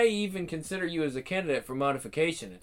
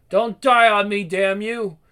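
louder, urgent shout at the dying man; desperate shout; 2 semitones higher, 8 dB louder than usual. Acted. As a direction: yell to keep him alive.

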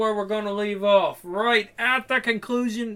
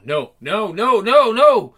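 alarmed refusal; 3 semitones higher, 7 dB louder, slower than usual. Acted.

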